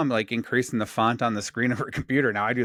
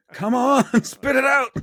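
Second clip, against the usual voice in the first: high, loud playful exasperation; mock impatient yell; 11 semitones higher, 5 dB louder, slower than usual. Mocking someone reading huge text letter by letter, impatient yell. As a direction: jokingly hurry along a painfully slow talker.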